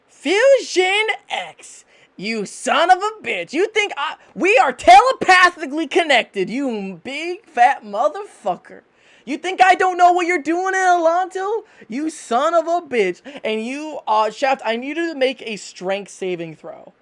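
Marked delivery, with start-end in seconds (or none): 0.1-3.5 high, drawn-out furious scolding; enraged knife spirit. Acted. Knife spirit cursing her husband in his head, furious. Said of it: "you hear this in the back of your head". 3.5-13.2 high, loud spousal tirade; angry yelling. Acted. Telepathic knife scolding her cheating husband, angry yelling. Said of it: "What the fuck are you yelling at again?".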